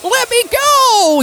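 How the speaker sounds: high-pitched drawn-out wail; panicked captive. Acted. Josh Agad held down by an orc cop, high-pitched pleading. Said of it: "and he's like".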